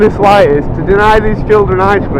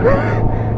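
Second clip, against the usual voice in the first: brief laugh. Real, not acted. Laughing at own slip.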